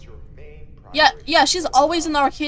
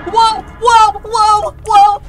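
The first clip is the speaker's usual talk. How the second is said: loud excited whoas; excited shout; 7 semitones higher, 7 dB louder, much slower than usual. Real, not acted. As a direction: shout it out, amazed.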